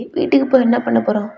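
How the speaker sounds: usual speaking voice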